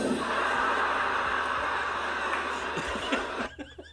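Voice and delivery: very high laughing shriek; real high-pitched laugh. Real, not acted. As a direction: crack up at the punchline. Listener cracks up.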